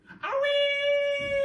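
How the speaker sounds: very high loud squawk; hen's shriek. Acted. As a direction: shriek like a startled hen. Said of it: "shrieked the hen".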